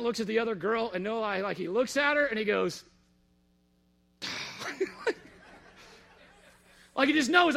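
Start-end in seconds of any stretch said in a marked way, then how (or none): 4.2-5.3 breathy high laugh; a short disbelieving laugh. Real, not acted. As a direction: laugh at the absurdity. Laugh at the culinary girl.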